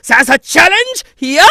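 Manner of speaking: uber-competitive, high explosive shout; over-the-top competitive battle cry; Svetlana. Acted. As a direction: lean hard into the competitiveness, very loud and high. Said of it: "just uber competitive".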